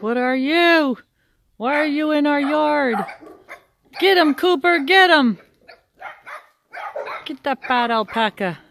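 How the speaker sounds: light high puppy voice, rising to excited yapping; barking puppies voiced. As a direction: playfully voice the puppies barking at the alpaca. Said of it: "They're like".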